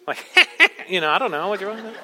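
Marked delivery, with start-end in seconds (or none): 0.3-0.8 high, hammed-up sinister cackle; mock sinister laugh. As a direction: cackle like a pretend villain. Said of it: "with an even more sinister voice".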